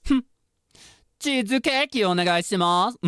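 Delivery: high, loud haughty princess voice; spoiled princess ordering dessert, high and lofty; hime dere. Acted. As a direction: toss it off like royalty. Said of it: "Just very, like".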